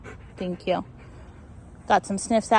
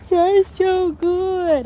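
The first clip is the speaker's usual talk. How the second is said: much higher, louder and slow, stretched out; slow high dog praise voice; gushing praise; 10 semitones higher, 7 dB louder, much slower than usual. Real, not acted.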